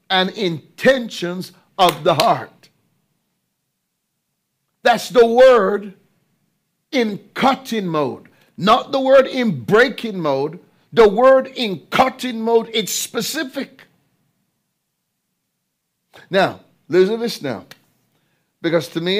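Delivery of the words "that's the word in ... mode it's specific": preaching emphasis. Real, not acted. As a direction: drive the point home from the pulpit.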